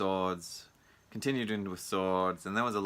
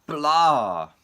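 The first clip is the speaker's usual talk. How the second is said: sarcastic mock cheer; 9 semitones higher, 11 dB louder, much slower than usual. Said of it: "they're like".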